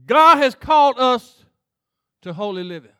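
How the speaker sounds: pastor preaching to the church. Acted. Preaching at full force.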